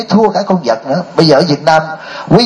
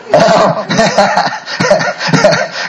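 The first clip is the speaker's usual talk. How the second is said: real laugh; 7 semitones higher than usual. Real, not acted. Laughs at his own story.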